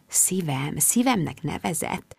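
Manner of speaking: soft, quiet wonder to herself; hushed inner thought. Acted. Bridget marvelling quietly that Mark called her darling.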